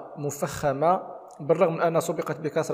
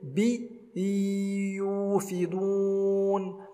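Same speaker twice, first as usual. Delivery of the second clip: slow, chanted tajweed recitation; measured tarteel chant; Quran recitation; 4 semitones higher, much slower than usual. Shown as a demonstration. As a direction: recite it melodically, drawing out the madd. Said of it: "إذن أقول".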